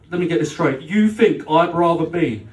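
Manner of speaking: ordinary talk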